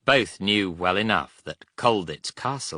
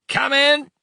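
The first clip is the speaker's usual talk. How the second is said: high, loud shout; yell; 10 dB louder than usual. A narrator's reading. Prisoner answers thunderous knocking on cell door, yelling. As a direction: yell through the closed door. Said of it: "he yelled".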